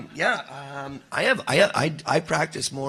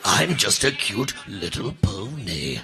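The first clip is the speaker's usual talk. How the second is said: sinister little pony; slower than usual. Acted. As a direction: play innocent while clearly up to something. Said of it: "a little bit of a little pony".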